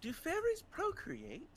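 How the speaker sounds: very high, slow, wondering voice; innocent in-character question. Acted. Puzzled companion asking the fairy about procreation, high and slow. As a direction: ask it slowly in a high little voice.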